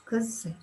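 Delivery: Kz with k then a soft z. Shown as a demonstration. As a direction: sound out Kz, k into a buzzing z. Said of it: "And when between two vowels".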